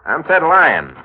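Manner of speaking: confident intro. Acted. Noir hero introduces himself, loud.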